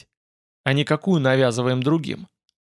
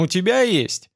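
mildly incredulous, higher-pitched question; distrustful; 5 semitones higher than usual. A narrator's reading. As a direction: ask with a touch of disbelief. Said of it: "спросил я с недоверием".

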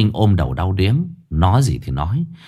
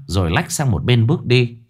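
plain reading voice

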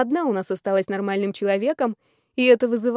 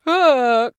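loud, higher groan; acted groan; 3 semitones higher, 6 dB louder than usual. A narrator's reading. Man slides down the wall laughing, groaning. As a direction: groan out a single cry. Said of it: "простонал Колчиновский".

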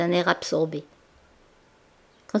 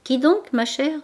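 higher, put-on innocent surprise; feigned surprise; 6 semitones higher, 3 dB louder than usual. A narrator's reading. Denise plays dumb to her cousin, with feigned surprise. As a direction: pretend not to know who she means. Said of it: "elle affecta la surprise".

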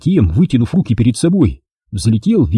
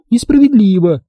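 whining lament; 10 semitones higher than usual. A narrator's reading. Mia protests that it is unfair, whining. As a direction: wail "Несправедливо" way up high. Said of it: "запричитала она".

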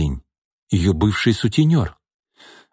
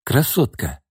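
venomous smirk; 6 semitones higher than usual. Acted. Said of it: "ядовито усмехнулся Шкворень".

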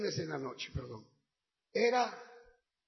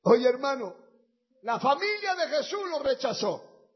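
lower, intimate invitation over music; altar call; 3 semitones lower than usual. Acted. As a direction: call them forward tenderly. Llamado al altar con música. (llamado al altar, íntimo y suplicante)

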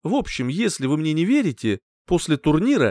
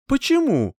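higher-pitched surprised question; 11 semitones higher than usual. A narrator's reading. Hero puzzled by his companion's delight, mildly surprised. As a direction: ask with mild surprise. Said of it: "удивился я".

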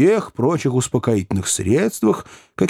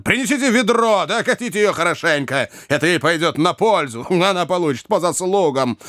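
spiteful orders; 6 semitones higher than usual. A narrator's reading. Enraged brother wants her doused.

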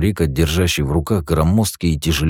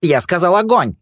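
high, loud barked command; yelled order; 13 semitones higher, 4 dB louder than usual. A narrator's reading. Boss yelling at his thugs to open fire, loud. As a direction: shout the order to fire at the men. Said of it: "орал своим жердяй".

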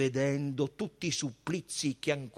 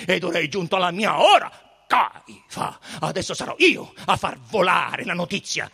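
loud, raised voice, building in force; Pilate's angry threat; 5 semitones higher, 9 dB louder than usual. A narrator's reading. Pilate threatens to send word to Caesar, loud and angry. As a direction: hammer each threat home.

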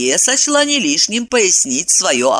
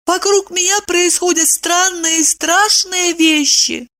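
very high, timid explanation; 13 semitones higher than usual. A narrator's reading. Wife tries explaining to angry husband, timid. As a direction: explain meekly in a tiny voice. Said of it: "робко добавила".

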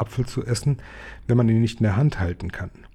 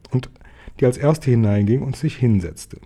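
usual speaking voice

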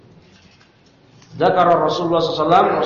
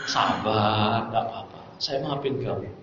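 softer, half-whispered pushover husband; meekly forgive the cheating wife, barely audible; mock forgiving husband; 7 semitones lower, 8 dB quieter than usual. Preacher mocks the husband who forgives his cheating wife, in a soft meek voice.

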